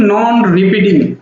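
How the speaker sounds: नॉन repeating said slowly, each word laid out; slow teacher emphasis; slow emphatic term. Shown as a demonstration. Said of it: "teacher drilling key term".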